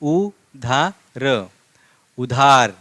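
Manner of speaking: उधार stretched very slowly; drawn-out reading. Shown as a demonstration. Teacher reading उधार aloud to students, very slowly. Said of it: "आगे देखो क्या है?".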